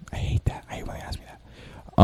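very soft, voiceless whisper; whispered aside. Real, not acted. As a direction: confide your dislike under your breath. Muttered aside after the dreaded question.

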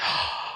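imitated sharp gasp of realization; shocked gasp. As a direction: suck in air, suddenly realizing.

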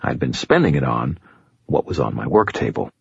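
usual speaking voice

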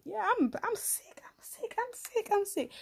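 high breathless whisper of pride. Retold or imitated. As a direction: whisper it high and slow, thrilled. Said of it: "I'm like".

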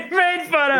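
high-pitched laughing through the words; helpless, squeaky laughter while talking. Real, not acted. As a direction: crack up at your own failure. Laughing at own failed accent.

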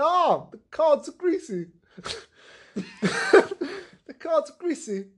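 high, slow hammed-up Italian accent; exaggerated Italian-accented lament; mock Italian accent. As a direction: play a distressed Italian caricature accent. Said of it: "why does he have a racist Italian accent".

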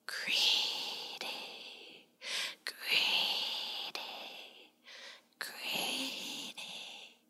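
fading, spooky whisper from the dark; eerie whisper. A narrator's reading. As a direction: hiss it like a hidden swamp spirit. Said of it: "heard it again".

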